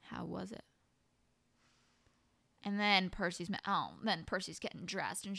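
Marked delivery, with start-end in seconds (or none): 0.0-0.6 quoting Sam in a whisper; whispered question. Retold or imitated. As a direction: ask it under your breath. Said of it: "Sam just goes".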